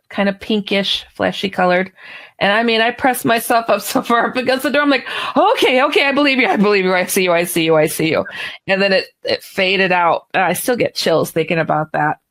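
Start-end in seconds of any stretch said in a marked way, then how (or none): 5.4-8.2 panicked. Retold or imitated. Pressed against the car door before the creature, panicked.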